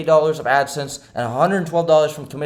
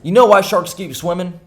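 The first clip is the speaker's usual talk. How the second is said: louder, higher attempt at a mobster's New Jersey accent; half-successful New Jersey wiseguy accent, pitched up; Tony Soprano accent; 4 semitones higher, 5 dB louder than usual. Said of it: "Tony's accent".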